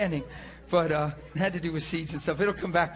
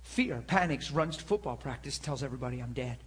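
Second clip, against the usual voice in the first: ordinary talk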